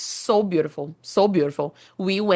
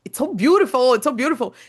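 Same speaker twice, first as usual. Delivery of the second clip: gushing excitement; 5 semitones higher, 4 dB louder, faster than usual. Real, not acted. Gushing about French language.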